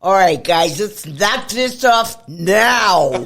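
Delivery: booming, slow barked command; drill instructor voice. Shown as a demonstration. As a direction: bark it like a drill sergeant. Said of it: "in your best drill instructor voice".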